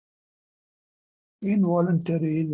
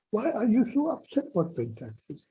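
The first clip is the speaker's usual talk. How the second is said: same question asked higher, incredulous; higher teasing repeat; 7 semitones higher than usual. Retold or imitated. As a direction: lift it up, mock disbelief. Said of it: "She was kind of".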